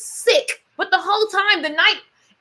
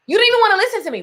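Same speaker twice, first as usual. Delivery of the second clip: ordinary talk, nothing marked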